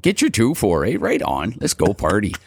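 light Canadian slang voice, "beauty, eh?"; Ontario accent. Retold or imitated. Mocking how Ontario people talk, lightly Canadian. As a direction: play a laid-back Ontario partier. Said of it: "Ontario people have this kind of".

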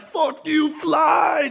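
very high shouted curse; mock furious yell. Driver yelling at the fly while crashing the car, high shout. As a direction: scream it while driving off a cliff.

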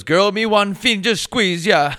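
put-on high, loud Jamaican accent, slow. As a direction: belt it in a Jamaican lilt. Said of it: "He goes".

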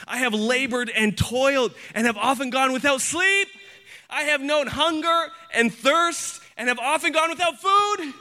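high, insistent sermon delivery building in intensity; impassioned preaching. Real, not acted. Preacher telling congregation of Paul's sufferings, fervent high preaching.

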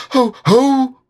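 a tiny animal trying to sound big; high, loud mock-fierce ho ho; as fierce as possible. A narrator's reading. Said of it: "as fiercely as he could".

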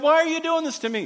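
high, loud exasperated scream; mock-yell at heaven in frustration; screaming at God. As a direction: believer screaming at God over a hard trial. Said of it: "you're screaming at God".

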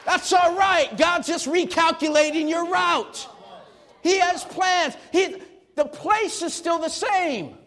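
high, loud preaching shout; full-force preaching. Acted. Pastor shouting encouragement to the congregation, full-force preaching. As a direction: preach it out to the whole room.

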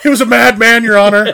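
high, loud, pleading cry; dramatic courtroom plea. Retold or imitated. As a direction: plead to the judge, overblown. Quoting Liar Liar scene.

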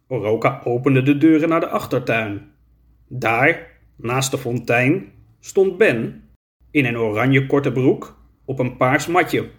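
ordinary delivery, nothing marked

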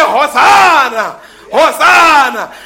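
slow ringing loud praise; shouted hosanna. Retold or imitated. People who understood scripture, shouting hosanna. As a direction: proclaim it out to the crowd. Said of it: "they say".

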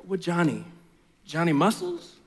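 groggy low friend woken up; sleepy friend. Acted. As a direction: mumble like you were just woken. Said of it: "this is how the story goes".

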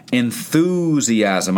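"Enthusiasm" stretched out slowly; drawn-out key word. Shown as a demonstration. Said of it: "Look at those words".

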